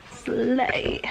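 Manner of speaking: breathy whispered slay. Real, not acted. Whispering slay to herself while reading.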